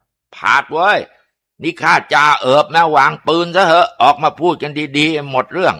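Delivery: put-on high-pitched shout to an old friend; จ่าเอิบ calling out. A narrator's reading. Police sergeant urging his old bandit friend to surrender, calling out. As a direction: call out to him, urging surrender. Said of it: "ตะโกนบอกในฐานะเพื่อนเก่า".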